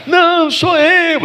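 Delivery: very high, drawn-out tearful plea; talking while crying. Acted. Joseph insisting to his doubting brothers, crying as he speaks. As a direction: act out a wailed insistence. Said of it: "tá falando chorando".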